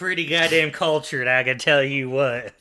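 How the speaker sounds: loud put-on Southern accent. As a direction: boast about Texas in a Texan accent. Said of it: "your southern accent there was just the funniest".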